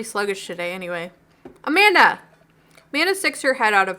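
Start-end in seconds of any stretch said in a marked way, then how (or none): 1.6-2.2 calling out, higher and louder. Acted. Dad calls his daughter out of her room, calling out. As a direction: call her name through the house.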